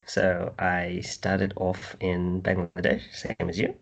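mock posh British accent; fake British accent. Guest starting his story, in a slow fake British accent. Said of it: "What is with this fake British accent".

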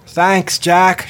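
loud, high called thanks; calling down. Acted. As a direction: shout the thanks down to the water. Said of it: "she called down".

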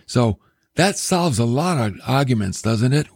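ordinary talk, nothing marked

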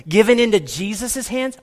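ordinary talk

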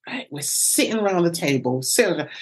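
ordinary delivery

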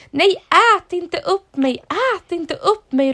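high, loud panicked plea; the dad crying out to the creature in fright; scared yell. Acted. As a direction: beg for your life while running. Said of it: "ropade pappa".

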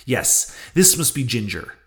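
plain reading voice

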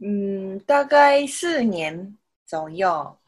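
ordinary talk, nothing marked

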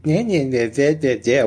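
singsong mock yeah-yeahs; dismissive kid mimicry. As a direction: mimic a kid tuning out a parent. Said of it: "How many times you were like".

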